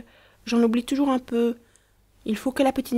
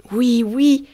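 higher, louder boast; proud, heroic; 5 semitones higher, 7 dB louder than usual. A narrator's reading. Boy swelling with pride before Marie, heroic boast. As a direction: puff up like a little hero. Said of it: "enorgueilli en prenant une pose héroïque".

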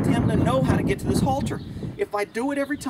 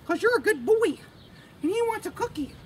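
praising the horse; 11 semitones higher than usual. Real, not acted. Trainer praises her horse in high baby talk. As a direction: gush over the horse like a pet.

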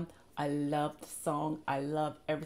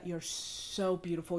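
normal talking voice

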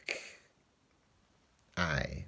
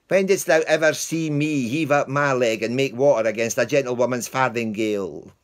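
loud, higher, indignant; scolding the dog; 5 semitones higher, 7 dB louder than usual. Acted. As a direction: tell the dog off properly. Lance scolding his dog.

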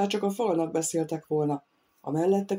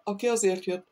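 plain reading voice

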